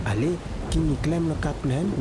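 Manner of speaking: usual speaking voice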